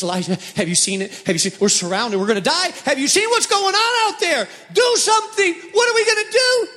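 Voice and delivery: from hurried worry building to a high, frantic shout; panicked servant. Acted. Servant begging Elijah to act, rising into a panicked shout. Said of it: "the servant goes to Elijah and he says".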